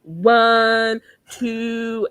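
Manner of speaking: loud drawn-out counting; counting aloud. Retold or imitated. Ergo counting at hide and seek, loud. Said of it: "He's like".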